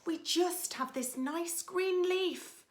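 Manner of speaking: high, meek little ants; timid ants. Acted. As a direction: squeak it out sadly. Ants' timid reply.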